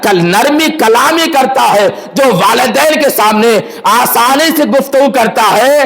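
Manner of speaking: high, fervent sermon delivery, pressing each line; impassioned preaching. Acted. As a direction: preach it out to the congregation, rising. Preaching rises to full force.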